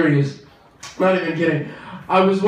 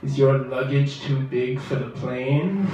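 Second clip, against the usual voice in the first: lightly done sultry insurance pitch; sexy ad voice; 4 semitones lower than usual. Retold or imitated. Comic mocking a seductive State Farm ad.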